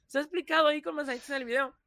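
high, fast impatient answer; it's in the video, obviously; mock exasperation at a commenter; exasperated reply. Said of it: "Y es como".

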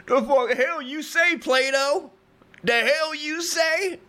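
high-pitched mock outrage; argumentative Diogenes. Homeless philosopher heckles his teacher in class, high comic outrage. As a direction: challenge him in a high, indignant voice. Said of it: "He argued loudly with Plato about philosophy".

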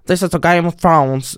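mock Scottish fan reading an audiobook; lightly put-on Scottish lilt; imitating a Scottish audiobook reader; Scottish accent. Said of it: "en sånn fan som hadde lest inn på skotsk".

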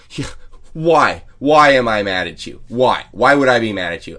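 loud, sarcastic repeated why, hammed-up anger; mock drunk yell. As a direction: throw the question back loudly at the girlfriend. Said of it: "And then I go".

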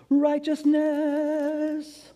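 "Righteousness" drawn out very slowly and high, intoned; holy intoned word. Shown as a demonstration. Preacher savouring a holy-sounding word, slow high intoning. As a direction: stretch "Righteousness" like a sacred chant. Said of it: "this holy sounding word".

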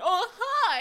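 high, loud eager hi. Retold or imitated. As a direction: gush the hi with delight. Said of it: "he's like".

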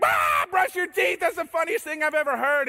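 high, loud, slowed giggly cry; mock hysterical delight; giddy laughing outburst. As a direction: act punch-drunk silly at midnight. Midnight giggles at a sleepover.

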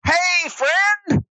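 high, squeaky cheerful toy voice; stuffed toy friend. Acted. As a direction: greet like a delighted plush toy. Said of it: "he goes".

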